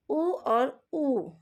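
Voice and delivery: उ और ऊ held very slowly, vowel by vowel; teacher stretching उ और ऊ; drawn-out vowel demo. Shown as a demonstration. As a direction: draw out उ और ऊ for the class to copy. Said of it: "जब हम उ और ऊ का उच्चारण करते हैं".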